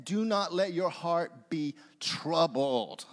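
slow line landing weightily on "troubled"; stress on TROUBLED. Shown as a demonstration. Preacher finishing word-by-word reading, stress on "troubled". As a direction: save the emphasis for "troubled" at the end. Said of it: "when you emphasize a different word".

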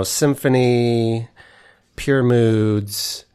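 usual speaking voice

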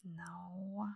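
whispered no. Real, not acted. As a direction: breathe it, no voice. Quiet aside while searching.

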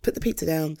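ordinary talk, nothing marked

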